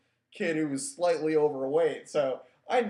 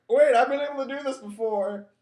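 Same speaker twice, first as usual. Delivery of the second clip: protest; 8 semitones higher, 6 dB louder than usual. Retold or imitated. Protesting while lifted.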